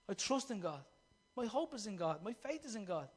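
quiet confession. Real, not acted. Quiet declaration of faith.

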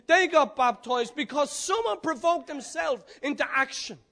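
loud, high revival preaching; preaching at full force. Real, not acted. Preacher telling the jailbreak story, loud and high. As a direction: drive the story home to the crowd.

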